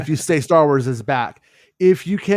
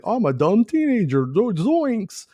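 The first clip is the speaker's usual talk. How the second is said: put-on higher, goofy dumb kid voice; mock dumb teenager; 8 semitones higher than usual. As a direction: play a clueless teen saying zoinks. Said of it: "it was like".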